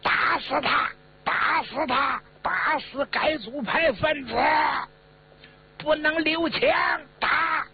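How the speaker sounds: very high, strained mob chant; high-pitched rallying cries; crowd shouting. A narrator's reading. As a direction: yell for punishment like an angry crowd. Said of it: "就喊上了".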